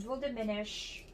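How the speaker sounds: ordinary talk, nothing marked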